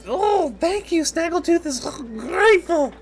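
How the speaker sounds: very high squeaky creature voice; high, loud kobold voice, drawn out at the end; grateful kobold. Acted. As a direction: squeal thanks like a small kobold. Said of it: "he goes".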